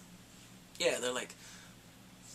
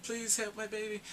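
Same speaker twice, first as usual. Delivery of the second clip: higher-pitched imitation of a begging mother; mild acted pleading; 6 semitones higher than usual. Woman begs for help for her baby, lightly acted plea.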